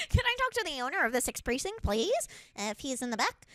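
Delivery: high-pitched, entitled customer demanding the boss. Retold or imitated.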